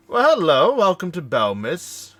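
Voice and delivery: high, loud, slow sing-song welcome; chirpy greeter. Acted. Harbor greeter welcoming the arriving crew, loud and high. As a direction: beam out a gushing welcome.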